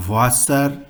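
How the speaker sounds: "Wasser" starting with a v sound; German pronunciation. Shown as a demonstration. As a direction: say "Wasser" as VAS-ser. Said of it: "German word".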